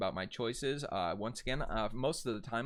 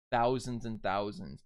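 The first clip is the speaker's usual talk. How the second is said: ordinary talk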